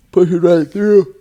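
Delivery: triumphant. Real, not acted. Proud of long workout.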